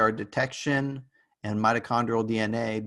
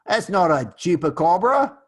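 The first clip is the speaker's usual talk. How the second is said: higher, louder hammed-up Australian accent; mock Australian accent; 6 semitones higher, 7 dB louder than usual. Lecturer jokingly debunking a chupacabra sighting to class, mock Australian accent. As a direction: put on a dubious, big Australian accent. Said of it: "all of a sudden I'm an Australian".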